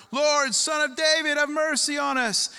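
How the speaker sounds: high, loud cry for mercy; shouted plea. Acted. Blind men crying out to Jesus, high loud plea. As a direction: call out desperately from the roadside. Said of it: "they shouted all the louder".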